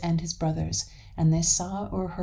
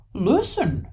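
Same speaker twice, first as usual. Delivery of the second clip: excited alert; 6 semitones higher, 6 dB louder than usual. Acted. Troll alerts Hans to the cuckoo.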